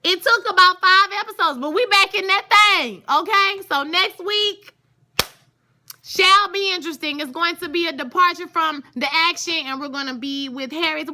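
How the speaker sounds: hyped-up, high and loud; can't contain the excitement, keep it loud; talking so loud. Real, not acted. Reviewer hyped about the show, loud and high-pitched. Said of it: "Why am I talking so loud?".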